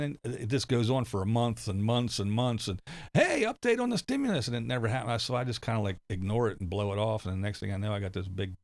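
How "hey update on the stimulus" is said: higher mocking clickbait announcer voice; mock hype announcer. Mocking YouTube videos about stimulus checks, in a higher hype-announcer voice. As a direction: parody the breathless YouTube news guy.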